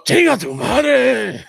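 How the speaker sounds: high, drawn-out shouted catchphrase impression; Tejano. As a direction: holler it in a hammed-up, stretched voice. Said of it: "Me doing Tejano".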